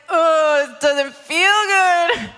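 drawn-out mock groan; pained complaint. Believer reacting to God's correction, pained complaint. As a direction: drag it out as a reluctant moan. Acts pain of being corrected.

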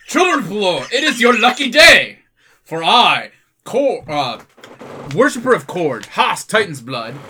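loud, high, proud declaration; booming strongman speech. Acted. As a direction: announce yourself like a flexing hero.

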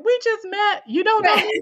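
high, incredulous and defensive; indignant inner thought. Retold or imitated. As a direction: scoff at a stranger's nerve. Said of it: "I'm thinking".

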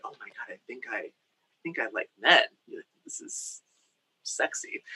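hushed, breathy inner-voice whisper; secret thought. Retold or imitated. A boy secretly realizing his crush, whispered to himself. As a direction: whisper it like a guilty discovery.

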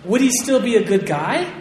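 ordinary talk, nothing marked

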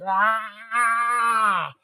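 short high scream; mock scream. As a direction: yelp it like a charging vampire. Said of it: "like, screaming".

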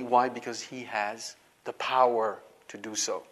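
ordinary delivery

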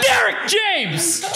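high slow angry announcement; furious name call. Retold or imitated. Graduation announcer calling name angrily.

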